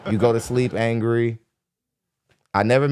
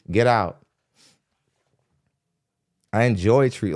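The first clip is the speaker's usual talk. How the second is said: ordinary talk, nothing marked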